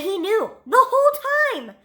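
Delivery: high, excited exclamation; giddy high-pitched outburst. Real, not acted. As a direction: pitch it way up, gushing over the reveal. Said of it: "I'm so excited".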